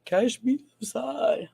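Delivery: hammed-up slow taunt; drawn-out catchphrase. Repeats the catchphrase, exaggerated.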